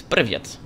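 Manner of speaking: "привет" said in Russian as pree-VYET, stress on the last syllable; Russian word привет. Shown as a demonstration. Teaching viewers a Russian word, says "привет" carefully. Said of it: "today's word is".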